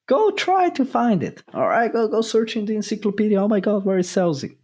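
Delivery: high-pitched, hurried mock searching; mock challenge. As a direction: rattle it off high, teasing a hopeless search. Mocking a futile dictionary search.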